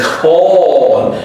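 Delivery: "call" with a very long rounded aw; dark L practice. Shown as a demonstration. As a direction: stretch "call" slowly into the L. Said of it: "let's practice with these dark L".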